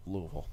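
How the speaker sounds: Louisville sunk into a low, soft LUV-ul; quiet, low Louisville squashed to two syllables; fewest possible vowels. Shown as a demonstration. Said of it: "a little vowels as possible".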